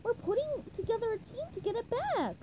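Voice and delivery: very high cartoon explaining voice; high-pitched, earnest character voice; SpongeBob. A narrator's reading. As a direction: explain it up in a squeaky register. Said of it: "SpongeBob explained".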